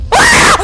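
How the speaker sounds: sudden shrill scream; real jumpscare shriek; scream of fright. Real, not acted. Jumpscare im Horrorspiel. (erschrockener Schrei)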